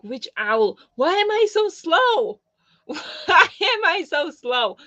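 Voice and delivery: high, slow, hammed-up Duolingo owl whine; mock owl voice. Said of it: "I was like".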